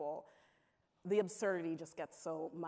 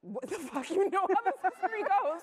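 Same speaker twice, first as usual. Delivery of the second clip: much higher, louder, incredulous scoff; exasperated sister; 14 semitones higher, 11 dB louder than usual. Retold or imitated. Sister scoffs at Caitlin's naive question, high and loud. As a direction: play the big sister mocking her naivety. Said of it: "and she was like".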